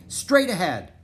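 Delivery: high, loud direction; yelled command. A narrator's reading. Annie steering the huskies, yelled command. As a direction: call the way to the team. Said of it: "yelled Annie".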